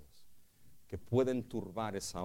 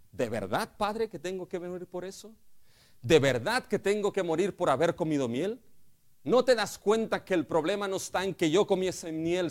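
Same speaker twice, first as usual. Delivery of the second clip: loud, high, urgent appeal to a father; the son confronting his father, loud and pleading; 8 semitones higher, 8 dB louder than usual. Retold or imitated. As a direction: press the father with rising questions.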